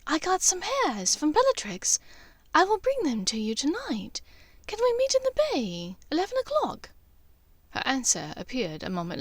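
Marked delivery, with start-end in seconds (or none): 0.1-6.9 higher, hushed urgent voice; urgent message. Acted. Draco secretly messages Hermione, hushed and urgent. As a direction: keep it tight and urgent, pitched up.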